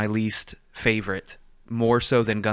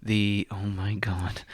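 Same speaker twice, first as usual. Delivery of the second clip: whispering; 5 dB quieter, slower than usual. Real, not acted. Realizes forgot the name mid-podcast.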